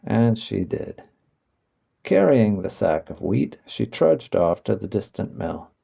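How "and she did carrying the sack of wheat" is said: ordinary delivery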